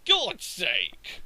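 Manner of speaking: loud, high-pitched retold disbelief; indignant mock outburst; incredulous exasperation. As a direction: protest as if cheated by the game. Retold disbelief at Lucario's damage.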